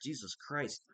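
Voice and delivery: ordinary delivery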